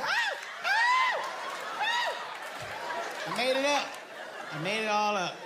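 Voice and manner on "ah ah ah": high panicked shrieks; panicked screams. Retold or imitated. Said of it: "And I panicked".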